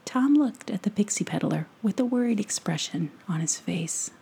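ordinary delivery, nothing marked

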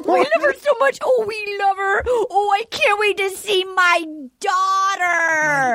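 high mock sobbing joy; hammed-up gushing mom. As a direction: gush tearfully, stretching the last word. Mocks mom gushing over fiancée.

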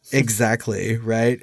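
ordinary talk

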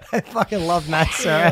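choked laughing outburst; blurting it through laughter; crying laugh; laughing while crying out. Real, not acted.

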